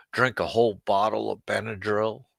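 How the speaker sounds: ordinary delivery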